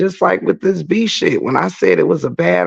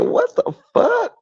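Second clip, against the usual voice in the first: shocked high yelp. Retold or imitated. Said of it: "I said".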